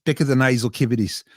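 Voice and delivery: put-on Aussie accent from a stage role; Australian accent. Shown as a demonstration. Showing host the accent learned for a play, Australian accent. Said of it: "my character had an Australian accent".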